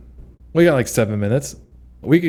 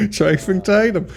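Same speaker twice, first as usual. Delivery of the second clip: laughing; 10 semitones higher than usual. Acted. Laughing repeat of joke.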